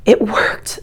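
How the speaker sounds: breathless relieved whisper. Acted. Janitor, relieved.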